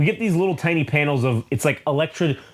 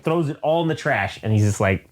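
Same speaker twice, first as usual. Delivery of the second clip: ordinary talk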